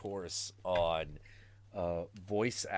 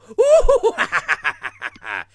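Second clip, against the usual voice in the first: high, loud whooping Joker cackle; hoot, then burst into manic laughter; hammed-up clownish villain laugh; Cesar Romero; 9 semitones higher, 16 dB louder than usual. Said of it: "you got the classic Cesar Romero".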